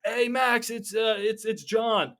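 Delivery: put-on a stranger claiming to be an old friend; high caller voice. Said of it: "it's like".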